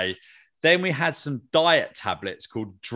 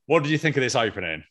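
normal talking voice

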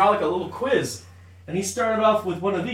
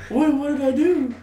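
confused friend; 8 semitones higher than usual. Retold or imitated. Quoting friend's confused reply.

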